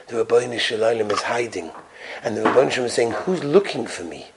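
low, soft, grave murmur; subdued and heavy, almost to oneself; hushed sorrow. Retold or imitated. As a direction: say it quietly, as a painful realization. Rabbi's sorrowful realization, retold.

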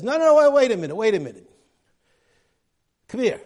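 sudden loud high stop order, easing off; an officer halting his men mid-draw; commandant calling out. Retold or imitated. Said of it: "and the commandant says".

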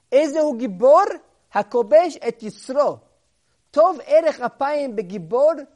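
loud, high, driving sermon voice; fervent preaching. Acted. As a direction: proclaim it to the whole room. Preacher quotes verse, builds fervor.

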